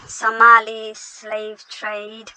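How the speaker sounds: ordinary talk, nothing marked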